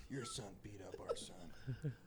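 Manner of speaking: hushed, breathy mock of a prim parent; whispered mock parent. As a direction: whisper it like a prissy mother.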